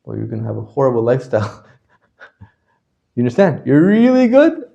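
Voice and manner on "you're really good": high, drawn-out rise on good; mock upswing. Teacher acting out the ups of a mood swing, high rising exaggeration. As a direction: swing right up, stretching the words out. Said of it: "up and down enough".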